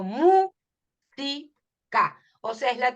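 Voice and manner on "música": música said as MÚsica, stress on the first syllable, a bit louder; right stress on música. Shown as a demonstration. Teacher to students showing the right stress, MÚsica. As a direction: hit MÚ hard in música. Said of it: "Digo música".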